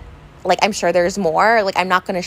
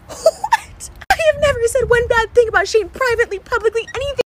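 very high, tearful disbelief; shocked; 13 semitones higher than usual. Real, not acted. Vlogger denying she ever said one bad thing, shocked high cry. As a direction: gasp it out, voice shooting up near tears. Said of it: "I'm so shocked".

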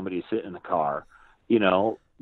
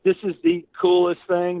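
higher, louder delighted gush; thrilled mom; 8 semitones higher, 5 dB louder than usual. Retold or imitated. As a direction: rave like a mom in a famous movie car. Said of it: "They were freaking out".

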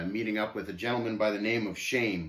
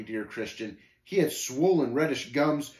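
plain reading voice

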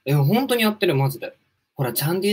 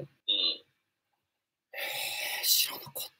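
ふざけてささやく; 息だけの声で「知らなかった」とこっそり言う; ひそひそ声; 7 semitones lower, 13 dB quieter, much slower than usual. Pretend. 下ネタにひそひそ反応. (内緒話のように小さく息だけでささやく)

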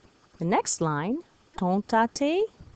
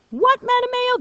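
shrill-free high squeak of disbelief, far higher and louder; mock student outrage; 8 dB louder than usual. Pretending to be a student objecting to the teacher. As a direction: play a shocked student protesting. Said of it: "That's crazy".

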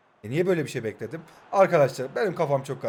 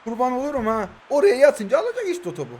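loud, higher, protesting footballer; indignant defender; 8 semitones higher, 7 dB louder than usual. Pretend. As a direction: argue back as the blamed defender. Voices defender protesting the blame.

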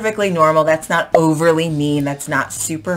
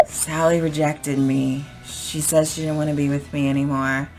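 dejected confiding; 3 semitones lower, 6 dB quieter than usual. Acted. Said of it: "then I might go and I might say".

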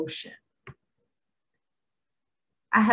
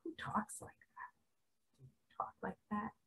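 hushed aside; 20 dB quieter than usual. Real, not acted. Aside after mocking commenters.

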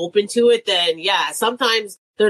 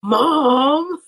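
put-on whiny teen; 6 semitones higher than usual. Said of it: "She's just like".

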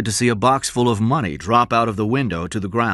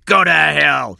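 louder defiant yell; shouted; 5 semitones higher, 7 dB louder than usual. A narrator's reading. Cy shouting defiance at his pursuer. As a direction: spit it back at him. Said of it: "Cy shouted".

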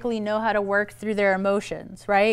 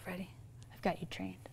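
quiet aside; 4 semitones lower, 19 dB quieter, faster than usual. Real, not acted. Quiet aside about audience.

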